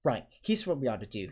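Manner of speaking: plain reading voice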